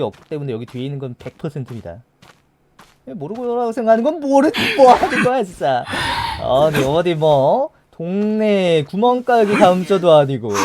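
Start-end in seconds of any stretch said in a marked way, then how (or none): 3.0-10.5 laughing rant. Real, not acted.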